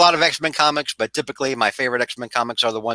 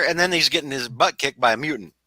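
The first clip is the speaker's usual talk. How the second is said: ordinary talk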